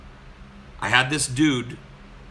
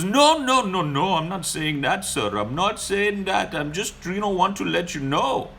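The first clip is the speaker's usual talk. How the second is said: flustered mock Indian accent; 9 semitones higher than usual. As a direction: backpedal fast in a put-on Indian accent. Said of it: "And he goes".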